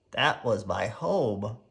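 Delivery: slow, low sniffling; sniffling sadly. A narrator's reading. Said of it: "sniffed Herman".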